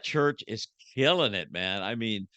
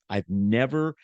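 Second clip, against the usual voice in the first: ordinary talk, nothing marked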